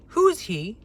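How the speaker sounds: mock the rude guest. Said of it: "so rude".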